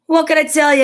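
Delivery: high, loud frustrated moose; Morris exasperated. A narrator's reading. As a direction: throw up your hands as Morris. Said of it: "asked Morris".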